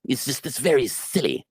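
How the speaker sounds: high, sniveling snake-tongued voice; Telos. Shown as a demonstration. Actor demonstrates a silly character, high serpentine sniveling. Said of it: "sniveling just by the way that he speaks".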